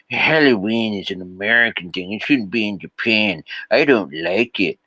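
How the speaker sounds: grumbling put-on cartoon voice; mock a crusty expat complainer; South Park-style caricature voice; crusty gaijin. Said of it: "you still have these crusty gaijin".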